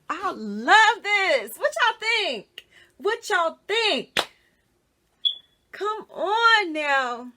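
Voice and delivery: loud, slow, high cries of delight; joyful shouting. Real, not acted. Showing her new wig to viewers, loud happy cries. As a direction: belt out the happiness. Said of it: "but I am so happy".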